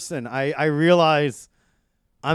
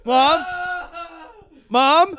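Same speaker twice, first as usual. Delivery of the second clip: high, loud questioning call; mock alarm; 13 semitones higher, 3 dB louder than usual. Son calls to silent mom on phone, high mock-worried cry. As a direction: call out for her, pitched right up.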